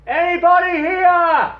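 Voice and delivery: drawn-out, very loud high call. Acted. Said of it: "he yells again, louder".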